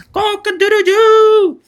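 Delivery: high, slow rooster crow. Retold or imitated. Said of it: "Aí tem uma galinha". (canto de galo)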